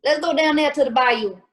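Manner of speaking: quick playful Cajun impression; Cajun accent. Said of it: "could you do a Cajun accent if you tried".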